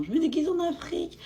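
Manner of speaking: high-pitched mock quote. Vlogger mimics people dressing up as an origin, mocking. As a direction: imitate them in a silly high voice. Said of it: "se déguiser en origine puis en disant".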